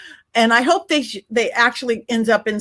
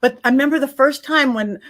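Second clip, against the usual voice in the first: ordinary delivery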